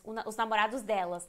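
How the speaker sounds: ordinary talk, nothing marked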